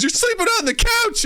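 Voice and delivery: high-pitched scolding wife; mock scolding wife. Wife orders husband to couch, mock squeaky scold. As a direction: banish him in a squeaky huff. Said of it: "She goes".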